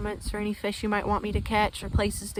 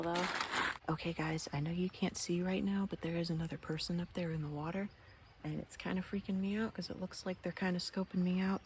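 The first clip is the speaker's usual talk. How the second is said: quiet; 8 dB quieter than usual. Real, not acted. Hiding from a watcher, quiet.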